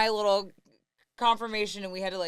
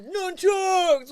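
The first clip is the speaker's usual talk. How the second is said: put-on high, loud boyish shout; hammed-up ninja cry; mock battle cry; 8 semitones higher, 5 dB louder than usual. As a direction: yell it like a kid playing ninja. Acts out boys playing with incense.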